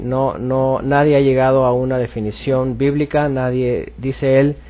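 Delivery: ordinary talk, nothing marked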